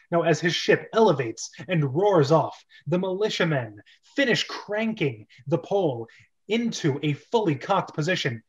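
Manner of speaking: normal talking voice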